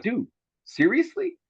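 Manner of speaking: imitating a slow, high-pitched disbelieving "seriously"; mock outrage, drawn out; incredulous. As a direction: stretch the question in fake disbelief. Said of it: "I got nasty with him".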